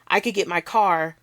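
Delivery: usual speaking voice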